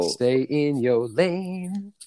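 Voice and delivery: drawn out very slowly, rising at the end; slow emphatic echo. Acted. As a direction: stretch every word out slowly. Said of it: "echoing advice slowly".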